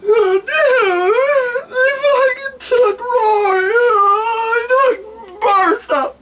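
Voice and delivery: high, wailing hammed-up sobbing; mock crying. Rory telling friends his Bertha got taken, mock sobbing. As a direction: blubber it like a heartbroken stoner. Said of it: "Rory's crying".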